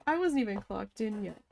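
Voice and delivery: ordinary delivery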